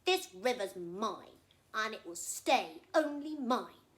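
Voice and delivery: loud, slow and smug; slow possessive crocodile. Acted. Crocodile gloating alone over his river, slow and loud. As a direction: lean on every word, it's all yours. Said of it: "he said".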